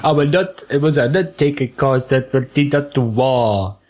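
stilted garbled royal speech; mock queen struggling to get words out; tight-corseted queen. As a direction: stumble stiffly through it as if laced too tight. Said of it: "not be able to speak properly".

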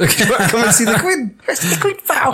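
high, laughing mock enthusiasm; chummy offer sent up in a high voice; mock eager invitation. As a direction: pitch it up, taking the mick. Mocking the Jedi's eager invitation.

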